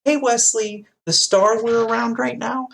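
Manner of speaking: fake-friendly, like coaxing a child; mock sweet Picard. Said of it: "and he's like".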